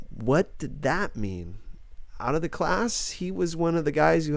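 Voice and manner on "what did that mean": ordinary delivery, nothing marked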